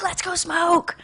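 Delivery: high-pitched little tempter; little party guy. Acted. Party guy tempting the new quitter to smoke, high coaxing voice. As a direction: coax in a small high voice. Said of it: "this little party guy who lived in my head".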